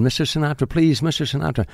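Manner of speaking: high-pitched imitation of a desperate fan; mock screaming plea. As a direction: plead high without really screaming. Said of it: "And she's screaming".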